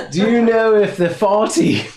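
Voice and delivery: put-on high, loud Liverpool accent; cheeky Liverpudlian question. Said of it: "you're the Beatle now".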